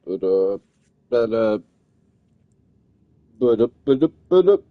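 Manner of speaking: stammering, rising to a shout; repeating words. Real, not acted. Stuck repeating filler words.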